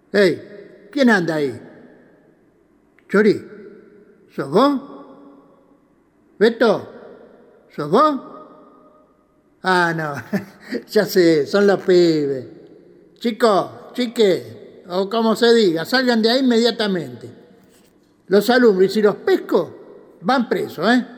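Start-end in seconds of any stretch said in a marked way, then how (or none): 0.1-8.2 louder, nervous calling into the dark. Acted. Grita a la oscuridad. 9.6-11.5 laughing with relief as it clicks; relieved laugh. Acted. Ríe al creer entenderlo. 13.2-21.1 louder, half-joking scolding call; loud mock threat. Amenaza a los chicos escondidos.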